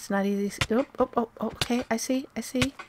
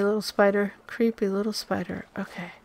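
ordinary talk, nothing marked

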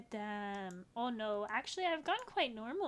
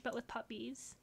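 ordinary talk, nothing marked

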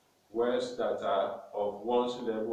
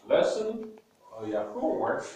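ordinary talk

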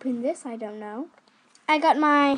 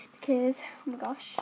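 whisper; 2 semitones lower, 7 dB quieter than usual. Real, not acted. Whispered aside.

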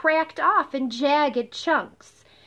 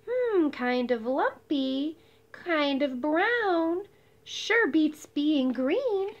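musing; 3 semitones higher, slower than usual. Acted. Verdi inspects the mud.

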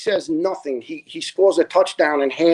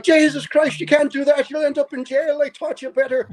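alarmed mom; 10 semitones higher than usual. Retold or imitated. Mom catching her boy with a knife, high alarmed scolding. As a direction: scold him like a frightened mother.

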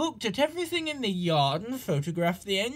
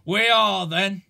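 loud stubborn retort; defiant; 7 dB louder than usual. A narrator's reading. Gordon defiantly answering his driver, loud. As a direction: shoot back stubbornly.

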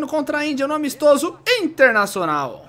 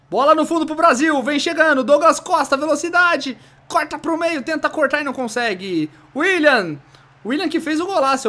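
lively play-by-play narration; goal commentary; 5 semitones higher than usual. Pretend.

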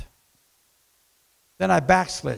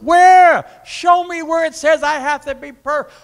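indignant challenge; 10 semitones higher, 9 dB louder than usual. Acted. Preacher challenging a critic to show scripture, high loud indignation. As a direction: shout down the imagined critic.

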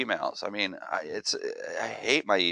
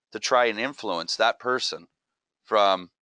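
normal talking voice

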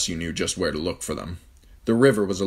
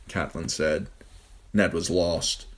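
ordinary delivery, nothing marked

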